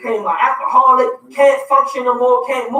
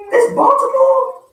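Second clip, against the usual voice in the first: much higher, slow, astonished question; amazed kids; 13 semitones higher, 3 dB louder, slower than usual. Retold or imitated. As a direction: play the kids' wide-eyed disbelief. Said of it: "They was looking at me like".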